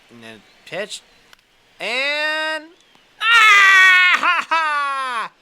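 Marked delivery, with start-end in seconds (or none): 1.8-5.3 high, loud hammed-up wail; mock anguished cry. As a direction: wail in fake despair over the miss. Painful miss in the game.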